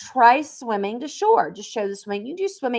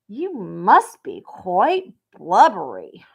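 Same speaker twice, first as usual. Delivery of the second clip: slow line reading; 2 semitones lower, 5 dB louder, much slower than usual. Shown as a demonstration. Voicing the mouse line.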